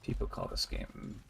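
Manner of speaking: low, very soft muttering under the breath; mock grumbling. Player complaining to themselves about an acclaimed game, mock grumbling. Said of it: "grumble, grumble, grumble, grumble, grumble".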